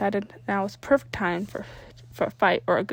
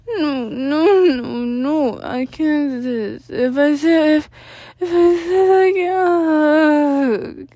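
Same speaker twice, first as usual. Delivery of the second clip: higher, louder tearful slurring; slurred crying; 5 semitones higher, 10 dB louder, much slower than usual. Acted. Danny refusing to sit, crying and slurred. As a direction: blubber it, slurring. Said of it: "he slurred".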